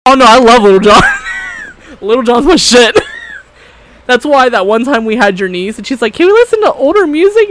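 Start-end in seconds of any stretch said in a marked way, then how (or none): 0.1-2.9 loud, laughing delight; excited shout. Real, not acted. 6.1-7.5 put-on high, loud girlish ask; niece voice. Voicing the niece.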